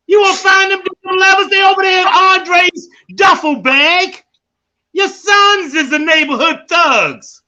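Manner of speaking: loud, high, hammering accusation; shouting rant. Pretend. Son finally yelling at his mother about his brothers, loud and high. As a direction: yell the truth he never dared say. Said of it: "I wanna say it so bad".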